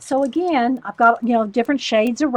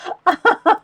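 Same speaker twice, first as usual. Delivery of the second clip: bright burst of real laughter, higher and louder; 10 semitones higher, 5 dB louder than usual. Real, not acted. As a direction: laugh out loud at your own story. Laughing at own school story.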